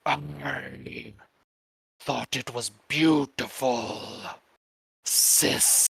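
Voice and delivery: slow, rasping whispered growl; demonic raspy voice. Acted. Demonic brother praising his sister's singing, slow rasp. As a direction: rasp each phrase out with long pauses. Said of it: "raspy voice, say".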